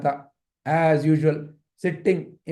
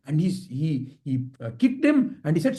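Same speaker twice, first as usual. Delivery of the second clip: plain reading voice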